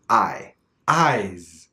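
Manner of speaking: "eyes" with the full open I sound; "I, eyes" with a wide open diphthong; unraised I in "eyes"; pure diphthong. Shown as a demonstration. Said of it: "the pure form of the diphthong".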